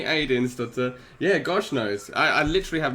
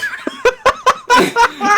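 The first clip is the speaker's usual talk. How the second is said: loud burst of high laughter; big laugh; 11 dB louder than usual. Real, not acted. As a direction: crack up loudly. Laughing at own Satan joke.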